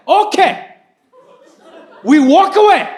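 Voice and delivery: high, loud announcement; announcing loudly. Retold or imitated. Dad bluffing the hot dog vendor, loud announcement. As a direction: call it out for everyone to hear. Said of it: "announces in front of everyone".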